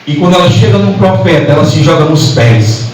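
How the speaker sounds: ordinary talk, nothing marked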